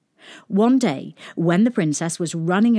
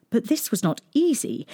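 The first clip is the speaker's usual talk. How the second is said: ordinary delivery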